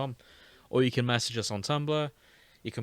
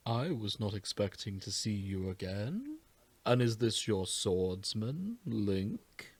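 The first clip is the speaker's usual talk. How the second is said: soft, slow, lightly put-on Rito elder; slowly, wondering; Meadow surprised; 5 dB quieter, much slower than usual. Meadow voiced in story.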